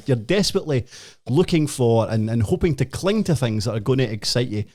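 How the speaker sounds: ordinary delivery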